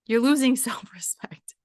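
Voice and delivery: whispered, breaking into a laugh. Real, not acted. Repeating the warning to her followers, laughing whisper. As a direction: whisper it with a chuckle in the middle.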